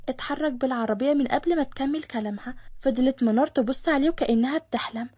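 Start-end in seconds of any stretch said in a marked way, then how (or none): none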